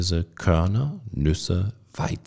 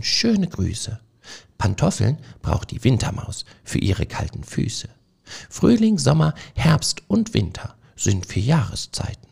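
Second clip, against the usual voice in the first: higher, declaiming poem recital; reciting from a stage; 5 semitones higher than usual. A narrator's reading. Frederik recites his poem to the mice as if from a stage. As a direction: proclaim the verses like a little poet on stage. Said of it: "sprach er wie von einer Bühne herab".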